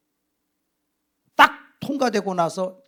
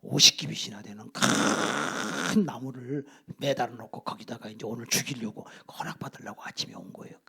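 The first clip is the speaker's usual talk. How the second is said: low, soft, half-whispered report; quiet courtier; 9 semitones lower, 8 dB quieter than usual. Acted. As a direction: lean in and confide it to the king. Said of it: "조용히 신하가 얘기합니다".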